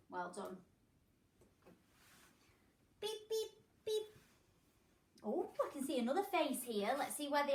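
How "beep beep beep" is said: machine beeps. Pretend.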